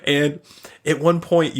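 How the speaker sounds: normal talking voice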